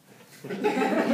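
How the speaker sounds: sudden high unvoiced burst of laughter. Director laughing at the actor's take, high delighted burst. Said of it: "John goes like this".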